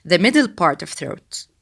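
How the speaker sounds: ordinary delivery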